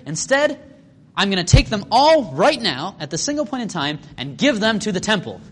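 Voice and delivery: hammed-up self-righteous giving; mock smug son. Pharisee-taught son mocking parents.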